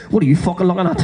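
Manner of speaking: lightly growled hard-man challenge with a laugh; a half-laughing hint of a psycho picking a fight; mock mirror threat. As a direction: square up to your own reflection. [name] squaring up to mirror.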